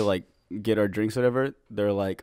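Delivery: usual speaking voice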